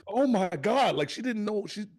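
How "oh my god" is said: high-pitched imitation of alarm; mock fearful. Stranger insulted by the aunt, mock fearful. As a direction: play a frightened stranger. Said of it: "she was in fear of her life".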